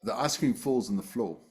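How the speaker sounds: usual speaking voice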